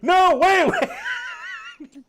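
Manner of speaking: high squealing laughter through words; laughing. Real, not acted. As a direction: crack up mid-sentence. Laughing at telemarketer's panic.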